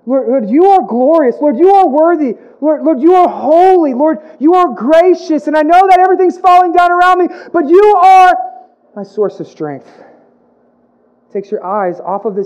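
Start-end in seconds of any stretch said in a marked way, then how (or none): 0.0-9.9 rising, louder praise to the Lord; worship prayer. Retold or imitated. A pastor showing the church how to praise God in hard times, in building fervent praise. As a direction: build praise until it soars, then settle back. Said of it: "You say".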